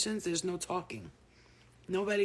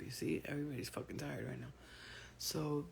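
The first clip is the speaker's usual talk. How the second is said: normal talking voice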